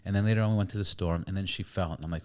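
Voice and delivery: normal talking voice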